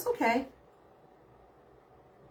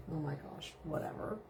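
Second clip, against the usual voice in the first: usual speaking voice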